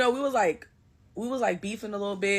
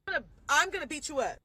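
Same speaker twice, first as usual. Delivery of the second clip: normal talking voice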